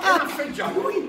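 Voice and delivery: high, disbelieving squeak; can't believe there's just one cup; shocked question. Retold or imitated. Apprentice appalled at sharing the old lady's cup, a high shocked squeak.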